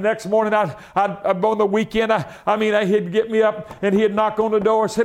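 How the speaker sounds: normal talking voice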